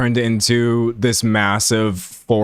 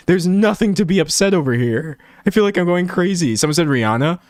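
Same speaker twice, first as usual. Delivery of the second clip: usual speaking voice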